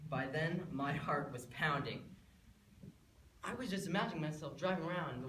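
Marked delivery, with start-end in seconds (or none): none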